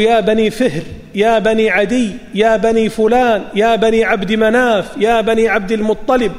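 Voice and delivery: usual speaking voice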